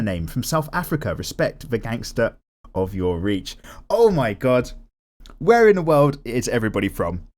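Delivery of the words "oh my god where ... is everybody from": ordinary talk, nothing marked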